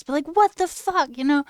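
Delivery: high, loud exasperated swear; outraged; fed-up outburst; heated. Real, not acted. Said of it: "getting so heated".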